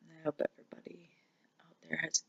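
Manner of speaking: real tears, whisper the goodbye; tearful whisper. Real, not acted. Ending her vlog to viewers in a tearful whisper.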